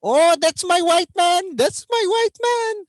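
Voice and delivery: put-on high, loud Filipino-accented cheer; imitating Filipino parents bursting with pride; proud Filipino parent.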